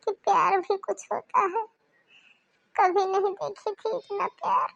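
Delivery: high, tearful and wavering; crying again. Acted. Bride telling family she never knew love, tearful. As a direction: speak through fresh tears of relief. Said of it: "आप फिर रोने लगी?".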